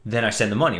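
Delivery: ordinary delivery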